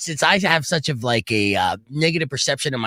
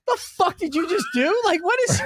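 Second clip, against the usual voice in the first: much higher, laughing disbelief; stunned disbelief. Retold or imitated. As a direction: gasp at what he just did. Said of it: "I'm looking over like".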